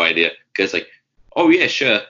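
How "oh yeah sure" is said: light attempt at a British-Australian accent, higher pitched; a touch of Aussie-British lilt, pitched up. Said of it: "That was my best British Australian accent".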